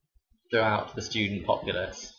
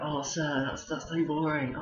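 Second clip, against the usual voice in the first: whiny pupil; 6 semitones higher than usual. Retold or imitated. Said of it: "it's like".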